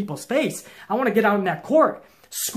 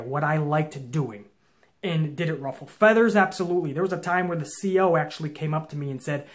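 normal talking voice

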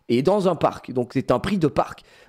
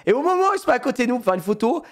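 usual speaking voice

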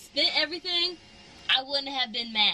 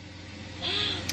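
surprised gasp; 5 semitones higher, 5 dB quieter than usual. Real, not acted. Sees the car details.

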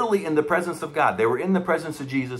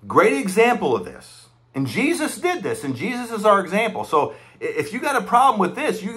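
raised, higher preaching push; fiery preaching; 5 semitones higher than usual. Acted. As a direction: preach it hard to the congregation. Preaching at full force.

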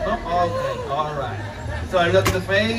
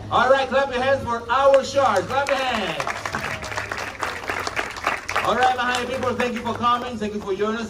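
announcer; 8 semitones higher than usual. Acted. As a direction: pump up the audience.

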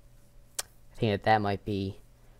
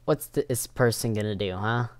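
usual speaking voice

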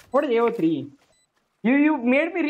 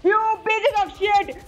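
furious gamer outburst; angry yell; 7 semitones higher, slower than usual. Real, not acted. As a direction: snap it out in gaming rage. Raging at enemy players.

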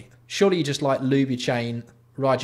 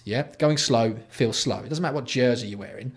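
ordinary talk

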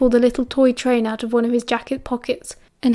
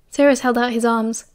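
plain reading voice

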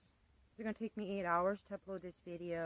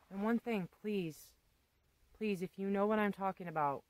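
ordinary talk

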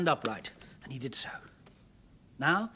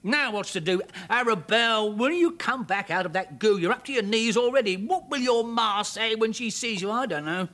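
mild shout; 5 semitones higher, 5 dB louder than usual. Acted. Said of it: "shouted the man in the bog".

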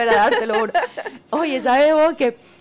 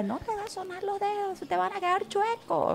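higher, much softer voice of a cautioning mom; mother's warning; 8 semitones higher, 8 dB quieter than usual. Retold or imitated. Host quotes mother on cracking fingers, soft high warning. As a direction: lightly imitate a mother warning her child. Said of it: "mi mamá siempre decía".

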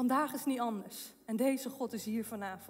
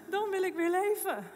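joyful; 6 semitones higher, 4 dB louder than usual. Retold or imitated. Repeated line.

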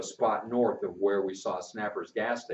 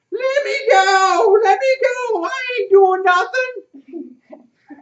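put-on high, loud, frantic screams of a caught boy; screaming boy; 10 dB louder, slower than usual. Caught boy screams at his captor, high and loud. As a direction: yell to be let go, crying out. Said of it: "How he did scream".

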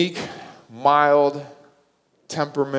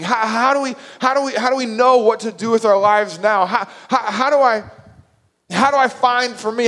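high, urgent, stammering plea; overwhelmed disciple begging for direction; frantic questioning; 9 semitones higher, 5 dB louder than usual. Retold or imitated. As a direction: pile up questions in rising desperation. Voicing Thomas's anxious questions.

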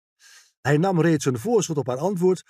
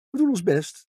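light, higher-pitched modest reply; bescheiden; 6 semitones higher than usual. A narrator's reading. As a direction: brush off the compliment modestly. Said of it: "zei ze bescheiden". (bescheiden, met lichte, hogere stem)

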